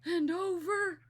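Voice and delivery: put-on high, very slow old droning storyteller; slow Historian voice. Shown as a demonstration. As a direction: drag every word out like an endless old lecturer. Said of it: "he just talked like this".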